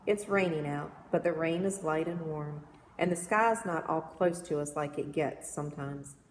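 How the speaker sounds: ordinary delivery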